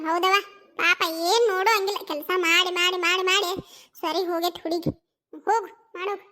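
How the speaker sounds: ordinary talk, nothing marked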